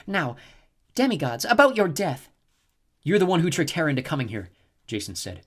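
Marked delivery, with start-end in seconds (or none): 0.1-2.3 higher, gloating villainess voice; a cruel goddess savouring her plan; cold snow goddess. A narrator's reading. Snow goddess taunting Jason, higher gloating voice. Chione threatens demigods. 3.0-4.5 louder, higher accusation; accusing. A narrator's reading. Jason accusing the snow goddess, raised voice.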